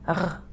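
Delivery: French "r" from the back of the throat. Shown as a demonstration. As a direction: demonstrate the throaty "r". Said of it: "where you have to use your throat".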